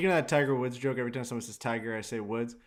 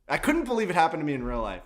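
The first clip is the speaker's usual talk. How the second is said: ordinary delivery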